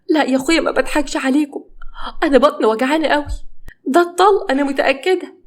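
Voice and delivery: high, insistent pleading voice; feigned labour pain. Acted. Wife convincing doubting husband she is in labour, mock pained insistence. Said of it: "بدأت تمثل إن هي بتتوجع وتصرخ".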